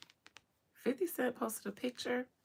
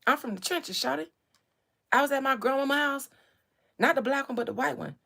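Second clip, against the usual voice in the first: loud, fast hyped A-Town voice; fast Atlanta rapper impression; 3 semitones higher, 6 dB louder than usual. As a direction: rattle it off like King. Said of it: "is what King said".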